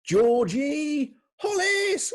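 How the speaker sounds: high, drawn-out fanfare shout; announcer's shout. Real, not acted. Host reveals next week's guest, big high shout. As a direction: stretch the name out in celebration.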